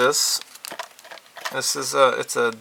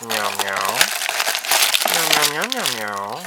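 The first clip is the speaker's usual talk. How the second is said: playful meowing; 7 dB louder than usual. Pretend. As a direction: meow like a playful kitten. Meowing at the kit.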